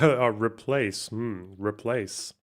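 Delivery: slow mock stress on replace, fading into a hum; mocking emphasis. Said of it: "You see that emphasis on the word replace?".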